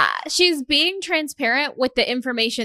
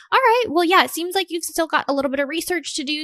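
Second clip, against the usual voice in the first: high, breezy easing-off, louder at the start; acted sales coordinator; 6 semitones higher, 4 dB louder, faster than usual. Coordinator giving a caller time to research, high and quick. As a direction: play a coordinator letting a caller go lightly. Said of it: "I'll just be like".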